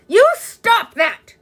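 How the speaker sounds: high, loud, slow indignant scolding; an old-fashioned girl scolding the teasers; angry. A narrator's reading. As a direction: stamp your foot and scold. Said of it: "she said angrily".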